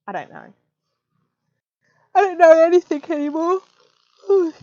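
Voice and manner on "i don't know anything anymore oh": words stretched and pushed out mid-yawn, louder and higher; talking through a yawn. Real, not acted. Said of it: "I didn't mean to yawn in your ear".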